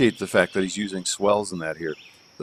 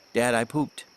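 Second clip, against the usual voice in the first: put-on coaching the child; 4 semitones higher than usual. Shown as a demonstration.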